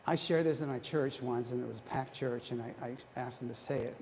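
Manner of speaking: ordinary talk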